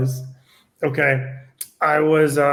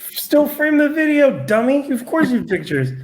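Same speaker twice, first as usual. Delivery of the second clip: exasperated mock retort; 11 semitones higher than usual. Investigator to client who wanted pictures, high exasperated retort. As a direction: pitch it way up, as if stating the blindingly obvious.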